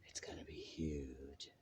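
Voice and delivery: hushed, breathy secret whisper. Acted. Whispered repeat of previous line.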